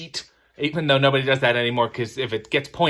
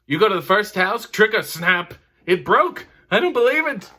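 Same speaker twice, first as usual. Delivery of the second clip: Seinfeld bit; 6 semitones higher, 3 dB louder than usual. Retold or imitated. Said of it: "Jerry is simply making an observation".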